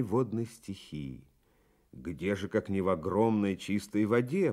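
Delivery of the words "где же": ordinary delivery, nothing marked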